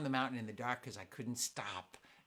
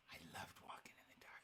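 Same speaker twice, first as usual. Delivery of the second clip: whispered; 19 dB quieter, much faster than usual. Acted. Whispering about the dark.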